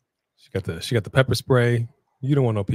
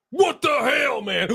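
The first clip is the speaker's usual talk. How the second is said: shocked shout; 12 semitones higher, slower than usual. Real, not acted. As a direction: yell it in disbelief. Reacting to chair shot.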